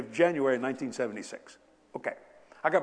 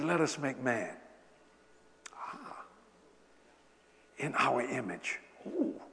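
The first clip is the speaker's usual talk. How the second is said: soft, slow reverent reading; hushed awe; 3 semitones lower, 8 dB quieter, slower than usual. Acted. Preacher reading God's creation of man, soft slow awe. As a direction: breathe it like holy words.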